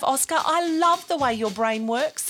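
ordinary delivery

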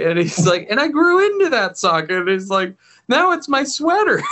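high squeaky little dog voice, way up; chirpy little dog telling a sad story cheerfully; chihuahua voice. Retold or imitated. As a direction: play the sweet naive chihuahua, pitched high. Chihuahua voiced retelling his story.

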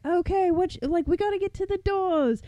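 high, quick Australian urging; Australian accent. Acted. Girlfriend rallying the panicking family to the doors, Australian accent. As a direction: take charge in a high put-on Australian voice. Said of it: "she's Australian, by the way".

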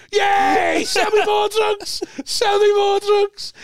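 high, slow, loud cheering; mock giddy shout. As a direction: yell it high and slow like a thrilled drunk. Mate shouting happily for drugs.

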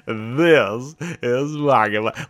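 slow, loud radio show opener; Guy Rizdall. Acted. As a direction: stretch out a smiling host's greeting. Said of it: "Like Guy Rizdall when he starts off Marketplace".

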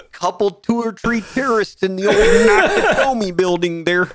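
put-on broad comic Canadian accent. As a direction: lay on a thick mock-Canadian lilt. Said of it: "That was a good Canadian accent".

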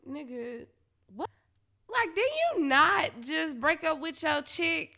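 ordinary talk, nothing marked

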